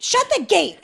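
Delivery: high, loud yell imitating an angry stranger; imitated scream. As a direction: scream the order like the man did. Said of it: "some guy screams at me going".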